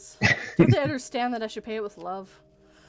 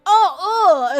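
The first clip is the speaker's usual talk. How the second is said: higher, loud grossed-out groan; mock disgust; 7 semitones higher, 10 dB louder than usual. Kids reacting to mom's breath, loud disgust. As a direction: recoil like kids smelling bad breath.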